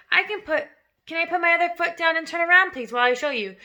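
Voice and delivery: put-on a keen child rushing his request; eager child voice. Small boy pleading to the headmistress, eager and rushed.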